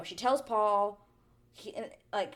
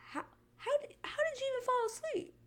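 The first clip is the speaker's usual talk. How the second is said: very high, squeaky disbelief; high-pitched incredulous question; baffled; faster than usual. Real, not acted. Baffled reaction to her.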